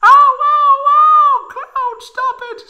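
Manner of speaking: high, loud falsetto yelps of pain; snapping in pain. Acted. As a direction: yelp and snap at him in a high girl voice. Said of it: "snapped Tifa".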